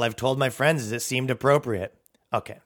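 ordinary talk

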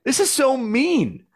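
high, loud anguished pleading at God; reliving a tearful rant at heaven; yelling at God. Retold or imitated. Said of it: "yelling at God".

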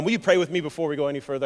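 usual speaking voice

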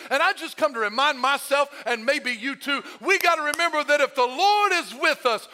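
high, loud preacher's delivery; full-force preaching. Real, not acted. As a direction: preach it hard, building to a shouted peak.

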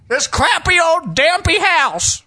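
high, slow, drawn-out comic holler; Rosie O'Donnell. Acted. Film impression slips into another celebrity, high slow holler. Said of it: "went into a little Rosie O'Donnell".

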